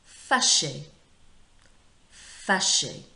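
"fâché" loud and distinct, ending in "ay"; model pronunciation. Shown as a demonstration. Teacher modelling "fâché" for pupils to repeat, loud careful pronunciation. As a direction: punch out "fâché" clearly with its accented E sounded. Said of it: "gives you your E sound".

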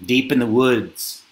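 ordinary delivery, nothing marked

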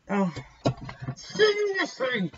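high, slow, drawn-out seriously; fed-up disbelief at the same card; exasperated disbelief. Real, not acted. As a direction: groan it out in disbelief.

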